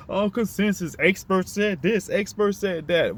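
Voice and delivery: mock people repeating what experts said; mocking parrot. Parroting people who trust experts.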